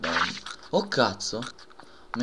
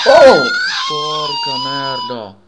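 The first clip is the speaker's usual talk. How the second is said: shrieking curse, much higher and louder; startled scream; 17 dB louder, slower than usual. Real, not acted. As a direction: scream as if ambushed. Huge jump scare.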